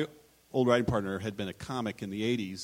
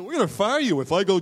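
mock threat; 9 semitones higher, 9 dB louder than usual. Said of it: "Jerry goes".

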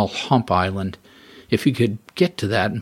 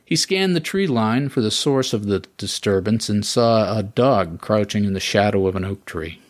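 ordinary delivery

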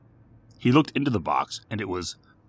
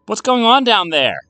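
high, loud questioning call; 13 semitones higher, 10 dB louder than usual. A narrator's reading. Mother checking on kids downstairs, calling out. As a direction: call it down the stairs.

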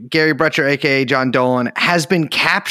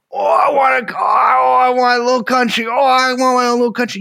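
mocking whiny wail; 8 semitones higher than usual. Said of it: "mocking whiny separatists".

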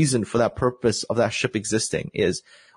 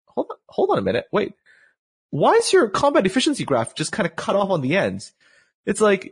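higher-pitched, incredulous questioning; incredulous objection; 7 semitones higher than usual. Retold or imitated. Player confronting developers over the cut-off graph, incredulous. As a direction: challenge them in disbelief, pitch jumping up.

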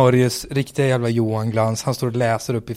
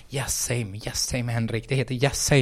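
much softer, murmured aside; mock muttered correction; 9 dB quieter than usual. Colleague correcting him from his seat, murmured softly. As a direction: quietly correct him from a chair. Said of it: "säga från sin stol bara så här".

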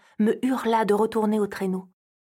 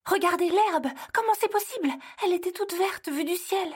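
high astonished cry at the grey grass; alarmed; 8 semitones higher than usual. Acted. Elf shows Santa the grey grass, high and astonished. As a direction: point and gasp in disbelief.

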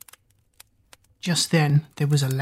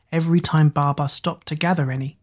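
ordinary delivery

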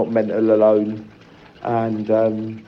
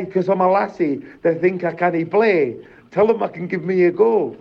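mum's defiant protest, higher; imitating mum; 6 semitones higher than usual. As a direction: voice mum standing up for herself, pitched up. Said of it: "And she was like".

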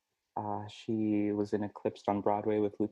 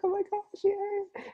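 put-on very high, bursting kid's squeal of joy; imitating a child's delighted shriek; thrilled squeal; slower than usual. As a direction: squeal like a kid who just got the news. Kid thrilled about first audition.

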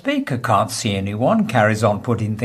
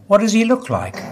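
a touch of surprised bartender; 9 semitones higher, faster than usual. Acted. Bartender's punchline.

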